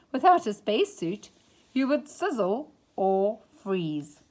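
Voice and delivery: plain reading voice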